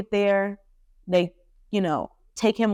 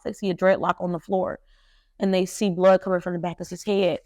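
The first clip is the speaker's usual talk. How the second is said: ordinary talk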